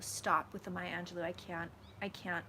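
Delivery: ordinary delivery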